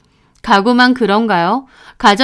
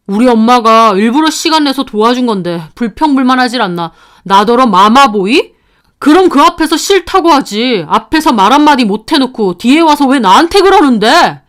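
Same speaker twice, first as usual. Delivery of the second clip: angry yelling; 2 semitones higher, 5 dB louder than usual. Acted. Boyfriend defends his mother to girlfriend, angry yelling. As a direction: yell your complaint, pitch climbing as you go. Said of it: "하면서 고함을 지르는 겁니다".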